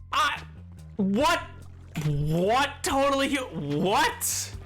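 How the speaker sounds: usual speaking voice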